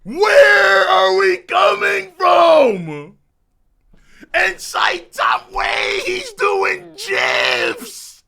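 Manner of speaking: high, loud, stretched hype scream. Real, not acted. Reader freaking out over the fight, screaming. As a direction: lose it completely at the page.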